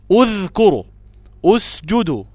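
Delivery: اذكروا and اسجدوا each begun on a clear u; اذكروا as udhkuru, اسجدوا as usjudu; opening damma. Shown as a demonstration. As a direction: model the u-start words loudly. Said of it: "أقول اذكروا".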